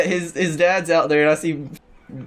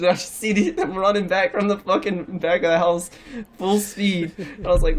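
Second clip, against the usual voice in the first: ordinary talk, nothing marked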